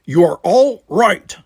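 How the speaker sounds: steady her with a forceful call; Worf voice. Acted. Worf repeats to panicked Tanya.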